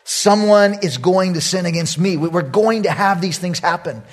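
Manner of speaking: usual speaking voice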